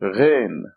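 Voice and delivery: Ghain with a gargled French R; throaty uvular gh in Ghain; gargling sound. Shown as a demonstration. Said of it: "It's a gargling sound".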